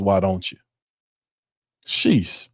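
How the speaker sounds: ordinary talk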